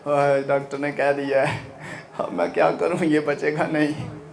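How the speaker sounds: low, soft mock-wailing mother; hushed, half-laughing imitation of a crying mum. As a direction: imitate a weeping mother, lightly. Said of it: "मामा रोना शुरू है".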